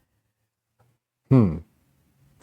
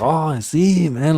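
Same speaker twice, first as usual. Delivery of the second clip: put-on Mexican accent; 6 semitones higher, slower than usual. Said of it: "teach me how to, like, talk like that".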